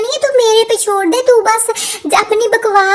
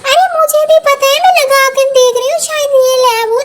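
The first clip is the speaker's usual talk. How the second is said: Kamla's voice; 2 semitones higher, 3 dB louder, faster than usual. Acted. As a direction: snap back while hunting the key. Kamla answering, laughing.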